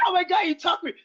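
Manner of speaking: high, mocking victim wail; a touch of fake outrage, high; mocking whiny voice. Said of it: "and then was like".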